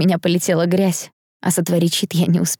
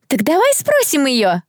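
high, slow, drawn-out jeering call; gleeful yell; 7 semitones higher, slower than usual. A narrator's reading. Someone in the crowd egging others on, gleeful yell. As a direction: holler it with malicious joy. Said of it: "радостно завопил кто-то".

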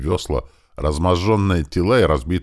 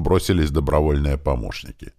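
ordinary delivery, nothing marked

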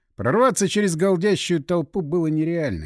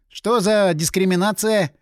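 drawn-out, higher and louder protest; indignant; 5 semitones higher, 5 dB louder than usual. A narrator's reading. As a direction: stretch it out in mock-grand outrage. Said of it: "возмутился Напалм".

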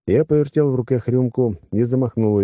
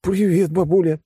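high-pitched goofy greeting; idiotic grin; 11 semitones higher than usual. A narrator's reading. Tipsy grandson greets his granny the general, goofily. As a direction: greet granny with a silly smile in the voice. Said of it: "идиотски улыбнулся Фант".

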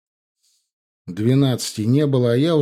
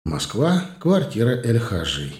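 usual speaking voice

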